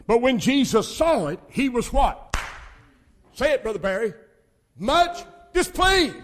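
loud, high revival preaching; hollering sermon, pushing every phrase; full-force preaching. Acted. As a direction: preach it out to the whole church.

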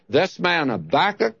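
ordinary talk

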